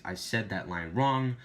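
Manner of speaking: normal talking voice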